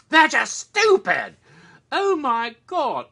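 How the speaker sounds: loud, high, slow cry of disbelief; exasperated shout. Real, not acted. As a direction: shout it out, unable to believe how dumb they are. Ranting about dumb movie characters.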